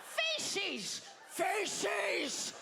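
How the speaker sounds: very high mock grandma voice; overbearing grandmother. Grandma orders for grandpa at restaurant, mock overbearing granny. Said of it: "my grandma would be like".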